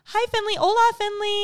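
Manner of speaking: put-on high sing-song kids' teacher greeting; sweet teacher voice. Mom imitating the teacher greeting her daughter sweetly. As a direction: coo hello to a small child. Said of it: "then she's like".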